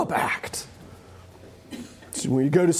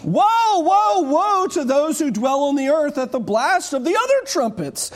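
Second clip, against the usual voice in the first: screaming eagle cry; 7 semitones higher than usual. Acted. Preacher as eagle warning all who dwell on earth, screaming. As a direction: cry it out from overhead to everyone. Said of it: "it's screaming to everyone".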